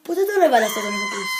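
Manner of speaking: high, fast, wailing cry; crying shriek. Pretend. A girl voicing a toy that wails at her viewers. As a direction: wail it like a toy throwing a tantrum. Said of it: "αυτή ουρλιάζει".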